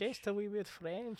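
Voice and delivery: echoing the friend in a mock French accent. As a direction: drawl it in a hammed-up French accent. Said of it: "go a little French".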